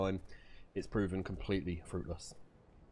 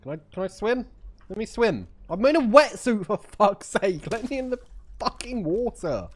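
high, loud indignant complaint; exasperated outburst; 11 semitones higher, 9 dB louder than usual. Real, not acted. Gamer demanding to be let into the water, loud exasperation. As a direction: rant at the game, pitched way up. Game won't let the player swim.

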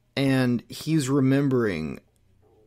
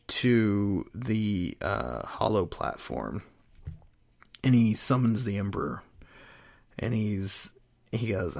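usual speaking voice

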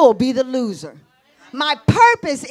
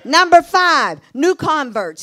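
louder, rising preacher's drive; full-force preaching; 6 dB louder than usual. Acted. Preacher firing up the congregation, loud. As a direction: preach it out to the church.

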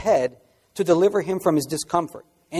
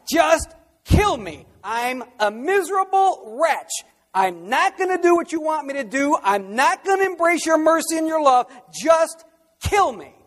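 Jonah shouting, high and loud, begging to die; desperate cry; 11 semitones higher, 4 dB louder than usual. Acted. Jonah shouting at God to just kill him, desperate. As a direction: hurl it at God like a miserable wretch.

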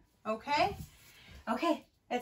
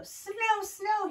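high, delighted exclamation; thrilled little frog voice; excited young frog; 10 semitones higher, 4 dB louder than usual. Acted. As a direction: cry it out high with excitement, a bit louder. Excited frog sees snow in story.

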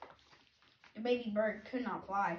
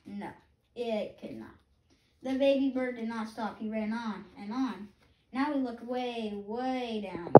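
ordinary delivery, nothing marked

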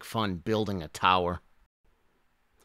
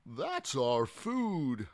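mock yell; 5 semitones higher, much slower than usual. A narrator's reading. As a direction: protest in a drawn-out character voice. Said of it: "yelled Apatosaur".

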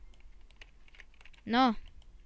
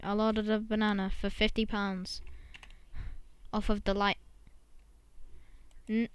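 usual speaking voice

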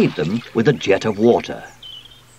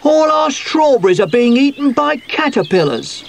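high, raised voice of alarm; dismayed cry; 4 dB louder than usual. A narrator's reading. Sooty finds caterpillars on the strawberries, crying out in dismay. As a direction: cry out the bad news. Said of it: "cried Sooty".

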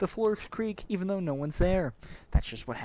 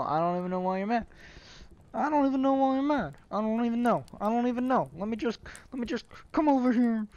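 higher-pitched, hammed-up Brooklyn protest; mock-indignant Brooklyn accent, pitched up; 6 semitones higher, 4 dB louder than usual. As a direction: argue back in a put-on Brooklyn accent. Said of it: "I don't know why I'm doing, like, a Brooklyn accent".

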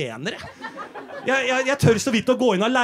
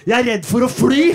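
louder laughing punchline; loud second try; 2 semitones higher, 6 dB louder than usual. Retold or imitated. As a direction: deliver the joke with forced gusto. (høy, lattermild replikk)

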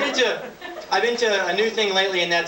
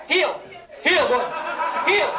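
dog command voice; 9 semitones higher than usual. Acted. Commanding pet bread.

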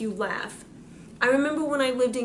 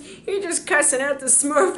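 ordinary talk

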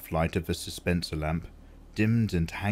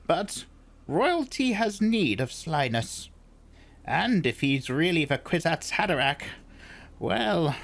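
put-on old crone voice; 9 semitones higher than usual. Crone continues her speech.

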